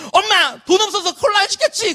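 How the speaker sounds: high-pitched, irritated whine at mom; acted annoyed outburst. Pastor as his teenage self blaming mom over cola, annoyed outburst. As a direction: mimic a teenager snapping in annoyance. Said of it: "엄마한테 막 짜증을 냈어요".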